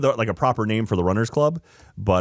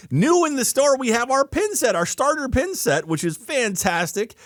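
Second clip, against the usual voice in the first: promo read; 4 semitones higher than usual. Acted. Ad read for the store.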